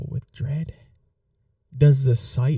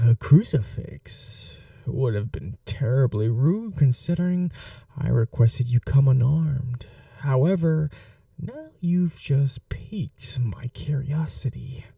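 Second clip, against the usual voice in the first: normal talking voice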